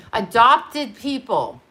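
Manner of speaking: slowly, weighing each word; drawn out like a lecture point; slow and deliberate. Real, not acted. Restart slower and deliberate.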